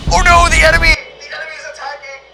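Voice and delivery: high panicked cry. Acted. Acted dogfight panic.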